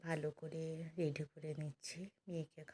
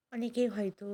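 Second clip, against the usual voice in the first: ordinary delivery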